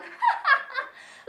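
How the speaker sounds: shrill burst of laughter; loud high cackle. Real, not acted. Laughs at own joke.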